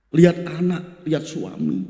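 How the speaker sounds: usual speaking voice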